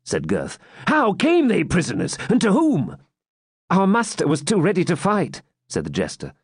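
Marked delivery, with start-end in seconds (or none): 0.9-3.0 much higher, urgent swineherd; alarmed swineherd. A narrator's reading. Swineherd voiced in story. 3.7-5.4 higher, light-toned jester. A narrator's reading. Jester voiced in story.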